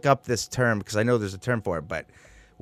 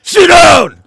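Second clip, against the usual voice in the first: barked order, much higher and louder; drill sergeant yell; 13 dB louder than usual. Retold or imitated. Bus driver ordering kids to stand, drill sergeant yell.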